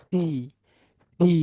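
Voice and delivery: ফি as "phee", puff of air first; aspirated P. Shown as a demonstration. Teacher spelling out the sound, ফি with aspiration. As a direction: begin ফি like Bengali ফ. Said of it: "সেটা ফয় রশ্মি হবে এরকম".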